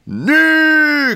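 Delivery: high-pitched drawn cry; cried out. A narrator's reading. Lecturer cries his word despite the slaps, high cry. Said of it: "cria Kanonov".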